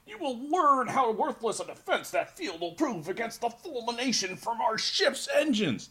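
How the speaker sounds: high-pitched, hammed-up alien villain accent; copied alien accent. Co-host copying the accent as Snark threatens heroes, alien villain accent. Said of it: "I'll try and do your accent".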